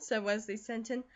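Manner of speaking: plain reading voice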